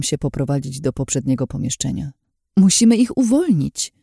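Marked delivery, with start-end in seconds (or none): 2.6-3.9 high, louder tense insistence; forced out tensely. A narrator's reading. Urging him to free the prisoners, tensely forced out. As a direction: push it out, pitched well up. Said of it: "wycedziłam".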